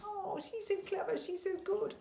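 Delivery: high breathy gushing praise; gushing admirer. Retold or imitated. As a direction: coo it like a starstruck fan.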